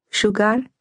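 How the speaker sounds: put-on Slovak-accented English, gently lilting; soft lilting Slovak accent. A narrator's reading. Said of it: "her Slovak accent soft and lilting".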